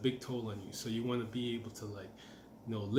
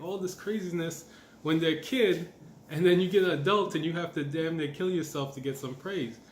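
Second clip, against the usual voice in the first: ordinary delivery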